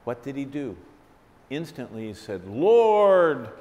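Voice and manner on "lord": higher, louder cry of a sinking man; desperate cry. Retold or imitated. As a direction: call out for rescue. Peter sinking, calls for help.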